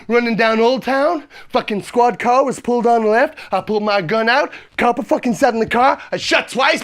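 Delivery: usual speaking voice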